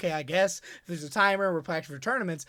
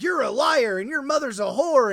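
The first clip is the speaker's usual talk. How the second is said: loud, higher mock yell of an online mob; mock mob shout; 5 semitones higher, 4 dB louder than usual. Online crowd yelling at the liar, a mock shout. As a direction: bark it like an angry Discord crowd. They're gonna be like.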